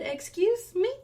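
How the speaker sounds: high-pitched offended question; indignant disbelief. Retold or imitated. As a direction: retell it as an affronted comeback. Retold quarrel with husband.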